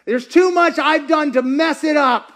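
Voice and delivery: high, loud protest; impassioned objection. Acted. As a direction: cry out the doubter's protest. Voices another doubter's objection.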